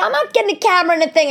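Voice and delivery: high, loud and fast refusal; indignant retort. Retold or imitated. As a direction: fire back a flat-out no. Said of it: "I said".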